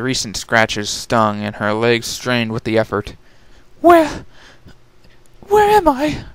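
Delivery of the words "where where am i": much higher, dazed posh pony voice; dazed lady. Acted. Rarity coming to in a dark cavern, higher and dazed. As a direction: wonder where you are.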